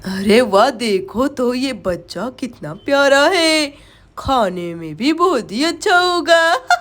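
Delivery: low, very slow witch voice; gloating witch. Acted. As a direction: savour the sight like a hungry witch. Witch admires the child as food. (चुड़ैल की धीमी, ललचाती आवाज़)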